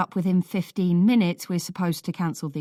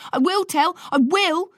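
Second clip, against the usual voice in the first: yelling; 12 semitones higher, 6 dB louder than usual. A narrator's reading. Child threatening his brother.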